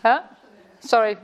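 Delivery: usual speaking voice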